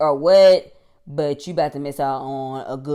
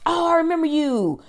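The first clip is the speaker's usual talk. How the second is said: fake friendly; 12 semitones higher than usual. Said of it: "going up saying".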